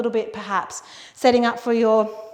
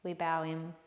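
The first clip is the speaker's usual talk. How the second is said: soft, hushed yoga closing voice; quiet, unhurried instruction with long pauses; guided relaxation; 2 semitones lower, 7 dB quieter than usual. Real, not acted. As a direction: guide them softly toward rest. Guides closing breaths and farewell.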